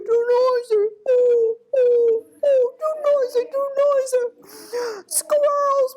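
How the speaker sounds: high, loud hooting owl voice; agitated owl. A narrator's reading. Owl complains to noisy squirrels below, high agitated hooting. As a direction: hoot and plead in a high, fussed owl voice. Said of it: "he was saying".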